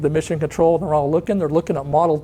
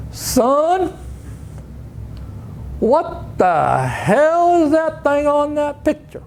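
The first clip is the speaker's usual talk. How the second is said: high, very slow West Texas boss voice, far from his own; John Roach West Texas drawl; 10 semitones higher, much slower than usual. Retold or imitated. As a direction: drag it out like an old West Texas boss scolding. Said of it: "he kinda talked like this".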